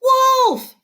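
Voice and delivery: put-on a bored boy faking alarm, loud and high; loud cry. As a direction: cry wolf at full voice to wake the town. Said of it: "A loud cry of".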